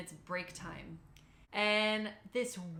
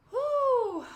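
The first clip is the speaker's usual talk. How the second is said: a high, loud blown-out whew; 11 dB louder than usual. Real, not acted. Bracing herself for the third round, loud high whew. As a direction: blow out hard before the heat.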